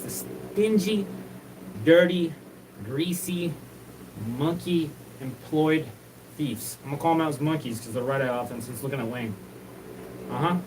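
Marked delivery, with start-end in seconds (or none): 0.0-6.8 each insult stretched out slowly, with pauses; slow emphatic list. Real, not acted. Calling out the thieves, slow emphatic insults one by one. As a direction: spit out every insult slowly and deliberately.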